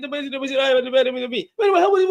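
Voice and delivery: high, fast wait a minute over and over; urgent interrupting. Real, not acted. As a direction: keep cutting in, pitched high. Cutting in on a shouting opponent.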